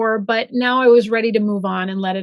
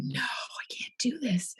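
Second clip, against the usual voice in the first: whispered to herself; inner whisper; 3 semitones lower, 15 dB quieter, slower than usual. Retold or imitated. Thinking at work that she can't go back, whispered. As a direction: breathe it as a private refusal. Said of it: "I think".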